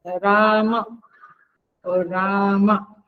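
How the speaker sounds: राम with the रा stretched to double length; long held vowel. Shown as a demonstration. Teacher showing students double timing, long held vowel. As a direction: draw out the आ in राम, slow and even. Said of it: "जब माँ बोलते हैं तो double time लगता है".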